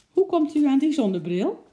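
high, loud astonished question; can't believe she sees her glasses; surprised lady. Acted. Lady asks the uncle about her sunglasses, astonished.

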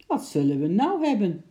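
astonished exclamation. A narrator's reading. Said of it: "riep de zeeman verwonderd uit". (luide, verwonderde uitroep)

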